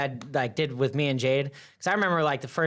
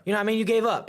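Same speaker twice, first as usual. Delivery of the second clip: high, exasperated mock scolding; raised-pitch, incredulous telling-off; imitated dad yelling; 7 semitones higher, much faster than usual. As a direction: play a fed-up dad chewing out his kid. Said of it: "your dad yelling at your ass".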